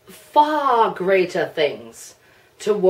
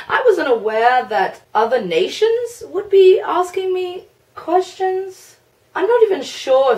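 surprised; 11 semitones higher than usual. Real, not acted. Surprised by nations asking questions.

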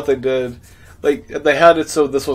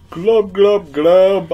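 gurgling fish man croak; 5 semitones higher, 7 dB louder, much slower than usual. Pretend. Fish voice while swimming.

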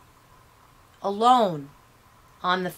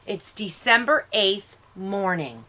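usual speaking voice

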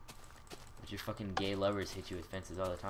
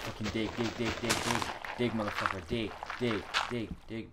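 loud high frantic dig dig; frantic urging; 4 semitones higher, 6 dB louder than usual. Real, not acted. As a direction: hammer it out in a panic. Digging away in panic.

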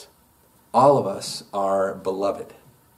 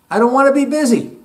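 emphatic outburst; 8 dB louder, faster than usual. Real, not acted. Preacher declaring rejection of busyness.

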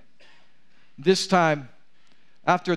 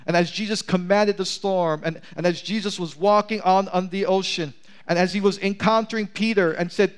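ordinary talk

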